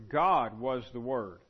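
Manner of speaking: GOD stressed loud and first, the rest slowed; emphasis on God. Shown as a demonstration. Teacher showing John puts that word first, emphatic on God. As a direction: lean hard on GOD, then unroll "was the Word" slowly. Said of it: "he puts that word first".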